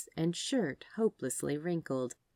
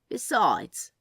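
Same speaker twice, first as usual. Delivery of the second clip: a lightly voiced, louder Crowley, teasing; Crowley's voice; 6 dB louder than usual. Crowley teasing the receptionist, a lightly voiced louder flirt. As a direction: toss the word off like a sly flirt.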